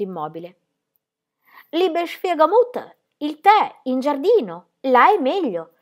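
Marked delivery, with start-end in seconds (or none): 1.7-5.7 high, slow, louder coaxing; sweetly insistent, pitched well up; smiling persuasion. A narrator's reading. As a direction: persuade through a determined smile. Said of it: "tentava di persuaderla Ingeborg, sempre sorridendo".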